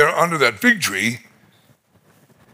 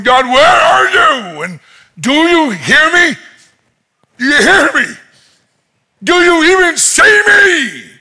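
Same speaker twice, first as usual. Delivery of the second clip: crying out to God; 9 semitones higher, 4 dB louder than usual. Retold or imitated. Nathaniel asking if God hears him, crying out. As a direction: holler up at a silent heaven. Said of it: "he's crying out to God".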